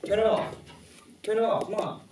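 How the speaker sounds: high, urgent command; agitated order. Acted. Telling the crew to turn it off, agitated high order.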